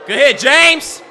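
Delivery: loud, high cheer; shouted praise. Real, not acted. Viewer cheering James's shot, shouted praise. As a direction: holler praise to the player.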